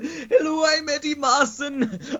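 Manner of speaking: very high, slow bad Welsh accent; hammed-up Welsh accent through giggles; Eddie Marsan. Podcaster mocking an actor's accent, bad Welsh voice. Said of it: "your bad Welsh accent".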